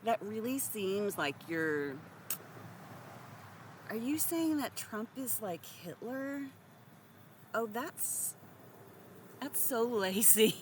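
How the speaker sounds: high, prissy, hesitant then scolding; a lightly voiced sanctimonious bystander; coy dithering that turns to a prim tut; mock demure voice. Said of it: "just going".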